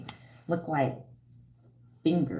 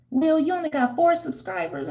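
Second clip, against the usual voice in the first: high teasing amusement. Real, not acted. Teasing a small channel.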